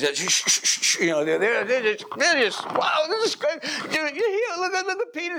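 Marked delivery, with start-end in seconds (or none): 0.0-1.0 loud urgent shushing. Acted. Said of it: "he says". 2.7-5.4 much higher, gushing delight; thrilled exclaiming. Acted. Believers excited seeing Peter.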